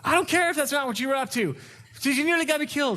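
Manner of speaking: high mock wife retort; high-pitched mock angry wife. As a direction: play the wife refusing the apology. Wife voiced in quarrel.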